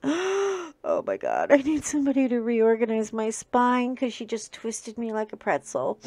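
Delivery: mock sore groan. Owner pretending to complain on Monday after wrestling the dog, mock-sore. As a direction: pretend she's stiff and twisted after grooming. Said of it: "talking to you going".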